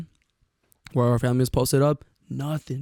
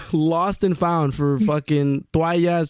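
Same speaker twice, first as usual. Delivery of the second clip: higher, words breaking into laughter; laughing while talking; 4 semitones higher, slower than usual. Real, not acted. Friend tells of finding the kid in lost and found, laughing. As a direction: tell it through real laughter, pitched up.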